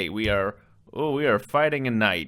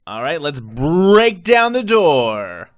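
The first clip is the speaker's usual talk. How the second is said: rallying call; 3 semitones higher, 9 dB louder, slower than usual. Acted. Rallying party to attack.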